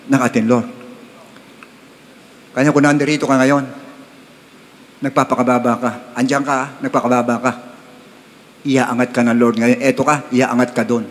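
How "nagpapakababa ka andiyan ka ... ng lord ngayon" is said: normal talking voice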